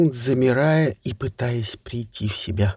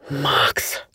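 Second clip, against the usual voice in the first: breathy whisper. Acted. Кай выкрикивает имя в экстазе.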